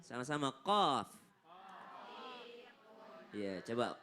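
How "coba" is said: usual speaking voice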